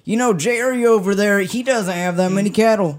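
high, loud mock Montanan neighbor; neighbor interview. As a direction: play the disapproving neighbor in an attack ad. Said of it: "It was interviews with his neighbor".

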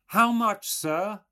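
put-on high, loud old woman's query; old milkwoman. A narrator's reading. Old woman asking Stephen how much milk, high-voiced. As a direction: ask in a high old countrywoman's voice. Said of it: "asked the old woman".

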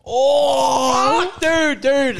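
high, loud cry of frustration; agonised outcry. Real, not acted. Player reacts to a near-miss match, agonised shout. Both guesses only half match.

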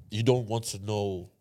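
normal talking voice

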